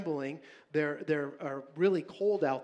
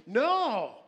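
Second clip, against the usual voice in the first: loud cutting no; sharp no; 6 semitones higher, 5 dB louder than usual. Real, not acted. Pastor cutting off his own example, loud no. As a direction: shout the interruption. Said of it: "Your kid's dead by that point".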